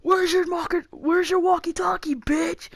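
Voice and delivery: high-pitched jeering call; taunting yell. Retold or imitated. Friend at the back taunting the actor, mock yell. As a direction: imitate a friend hollering a taunt. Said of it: "Logan yells".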